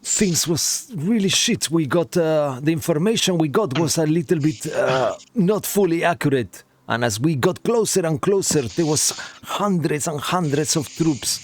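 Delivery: put-on breathless report in a light Russian accent; hint of a Russian accent; half Russian accent. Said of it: "There's the accent".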